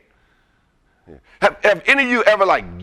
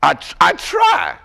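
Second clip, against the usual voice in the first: emphatic slow shout; slower than usual. Acted. Stressing his own effort.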